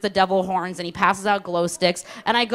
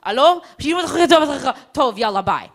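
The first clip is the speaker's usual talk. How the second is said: brisk Israeli on the phone; 5 semitones higher, 5 dB louder, much slower than usual. Retold or imitated. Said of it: "They go".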